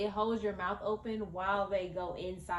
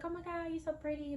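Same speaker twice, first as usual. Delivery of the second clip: high, soft gushing nurse; 8 semitones higher, 5 dB quieter, faster than usual. Retold or imitated. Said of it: "So she's like".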